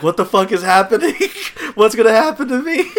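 mock panic. Stoned guy retelling his freak-out to friends, in a laughing mock panic.

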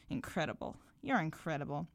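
low, soft, wry remark; quietly amused, dry; smirking. A narrator's reading. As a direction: mutter it with a smirk. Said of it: "turned back around and smirked".

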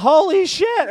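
loud, high-pitched imitated yell; retold shout. Host recalling what a concertgoer shouted, imitated shout. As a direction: shout it like a stunned audience member.

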